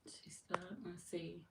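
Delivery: hushed breathy check; whisper. Real, not acted. Streamer checking whether the troll stopped, whispering. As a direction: whisper it while you look.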